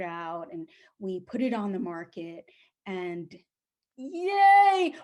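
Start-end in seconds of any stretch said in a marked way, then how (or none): 4.0-4.9 high, loud, drawn-out yay; mock celebration, pitched up; cheering. Seller cheering that the house found buyers, celebrating.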